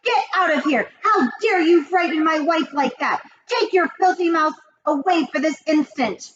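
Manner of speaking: a father ordering them out of the room; high, cracking angry orders; shouting. A narrator's reading.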